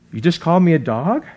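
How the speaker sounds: lightly acted disbelief, rising sharply at the end; mock affronted. Woman reacting to being called a dog, mock affronted. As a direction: ask it as if taken aback.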